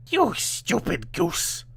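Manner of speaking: high slow scornful witch. A narrator's reading. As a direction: sneer at the foolish girl. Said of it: "said she".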